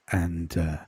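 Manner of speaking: usual speaking voice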